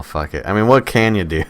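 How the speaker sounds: ordinary talk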